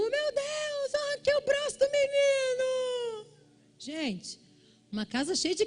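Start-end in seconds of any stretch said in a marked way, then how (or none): 0.0-3.3 high, wailing mother's scream; high panicked wail from the bedroom; aunt's panicked cry. Retold or imitated. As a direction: cry out in sudden horror. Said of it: "a minha tia deu um grito do quarto".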